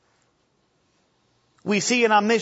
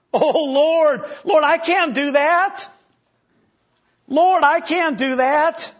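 higher, louder pleading protest; pleading whine; 5 semitones higher, 3 dB louder than usual. Acted. Jeremiah whining to the Lord that he can't. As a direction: whine like a scared boy begging off.

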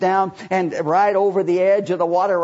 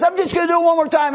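much higher, louder, fast cocky boast; 11 semitones higher, 5 dB louder, faster than usual. Acted. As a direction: toss it off, sure he can't miss. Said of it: "very cocky".

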